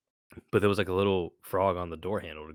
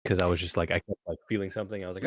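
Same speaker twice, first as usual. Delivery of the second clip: ordinary talk, nothing marked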